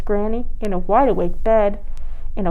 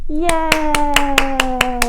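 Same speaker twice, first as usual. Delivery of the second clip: cheer; 5 semitones higher than usual. Real, not acted. Cheer after the story.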